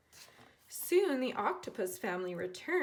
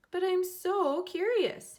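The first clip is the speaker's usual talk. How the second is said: high, loud and slow, full of curiosity; eager curious mermaid, high and slow; 9 semitones higher, 6 dB louder than usual. A narrator's reading. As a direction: stretch it out, dying to look inside. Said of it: "she said".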